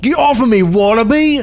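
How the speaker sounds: high, hammed-up Aussie yell; Australian accent. Fighting off a wallaby, high hammed-up Australian accent. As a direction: fend it off in mock Australian. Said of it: "our Aussie listeners are like".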